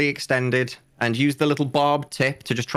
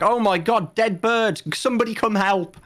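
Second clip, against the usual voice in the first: higher, panicked call for help; fussy alarm; 8 semitones higher than usual. Pretend. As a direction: kick up a fake fuss over the dead bird. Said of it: "I'd have to kick up a fuss and be like".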